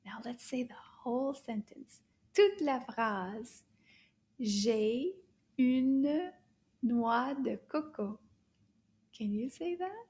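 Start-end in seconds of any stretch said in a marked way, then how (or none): 2.3-8.2 slow, word-by-word French modelling; drawn-out words with gaps between; slow teacher model. Shown as a demonstration. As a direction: say it slowly for learners to repeat. Teacher models sentence for repeating.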